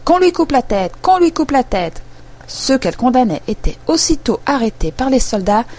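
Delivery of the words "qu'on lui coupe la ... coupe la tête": high, piercing royal shout. A narrator's reading. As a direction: bellow the order to behead. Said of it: "de crier".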